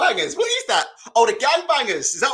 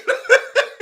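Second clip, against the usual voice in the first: short, loud, high burst of laughter; real cackle; 8 dB louder than usual. Real, not acted. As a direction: cackle at the sight. Laughs at the glazed look.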